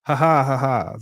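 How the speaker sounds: big loud forced laughter; loud fake laugh. Shown as a demonstration. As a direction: belt out a hearty fake laugh. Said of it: "I'ma laugh anyway".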